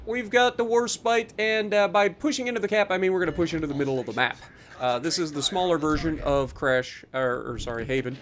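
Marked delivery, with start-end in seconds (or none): none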